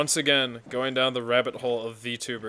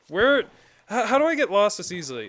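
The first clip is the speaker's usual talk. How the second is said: high, loud exasperation; exasperated; 9 semitones higher, 6 dB louder than usual. Real, not acted. Streamer lost in the game, high exasperated outburst. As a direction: wail it in frustrated disbelief.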